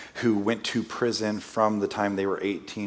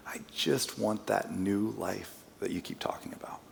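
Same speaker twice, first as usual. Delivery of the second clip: hushed retold plea; 9 dB quieter than usual. Retelling her tearful plea.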